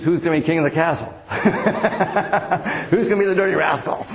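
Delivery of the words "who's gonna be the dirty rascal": chuckling through the question; teasing his wife with a laugh. Real, not acted. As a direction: laugh while you ask who's king. Teasing about fights with his wife.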